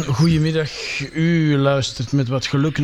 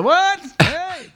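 deaf person shouting; 13 semitones higher than usual. Pretend. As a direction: play someone hard of hearing. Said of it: "so you might have to".

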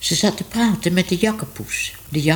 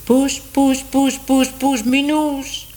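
high, drawn-out call across the rooftops; calling from afar; 10 semitones higher, slower than usual. Acted. Man calling Minoes over the rooftops, high and drawn out. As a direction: call the cat from far away, singsong. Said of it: "klonk een stem".

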